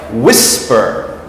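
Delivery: "whisper" with WHIS stressed, then falling; stress on first syllable. Shown as a demonstration. Teacher contrasting the two rules, first-syllable stress on "whisper". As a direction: punch the beginning of "whisper". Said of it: "stress is on the beginning of the word".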